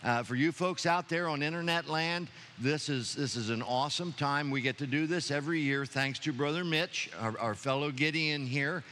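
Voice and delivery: ordinary talk, nothing marked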